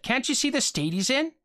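a hint of a wife reining him in; wife's voice. A narrator's reading. Wife defends exhausted son.

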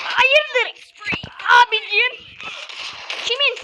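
high, loud surprised cries; startled gamer yelling; startled shout. Real, not acted. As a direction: react to a shock mid-game. Surprised by sudden kill.

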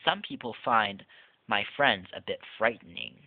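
lively, sing-song storybook read-aloud; reading to children. Acted. Reviewer reading the book Boo aloud to viewers, playful storybook voice. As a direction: read it like a Halloween picture book to little kids.

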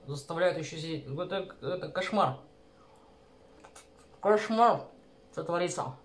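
food in mouth; eating while speaking; talking while chewing. Real, not acted. Seen 2: dumpling on fork at mouth throughout; she seems to be eating while talking.

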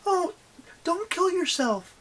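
high-pitched mock alarmed cry. A narrator's reading. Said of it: "she cried".

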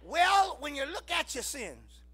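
ordinary talk

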